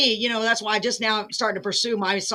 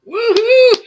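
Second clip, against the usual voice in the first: excited cheer; 14 semitones higher, 11 dB louder than usual. Real, not acted. Cheering after the song.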